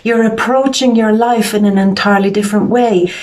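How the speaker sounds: normal talking voice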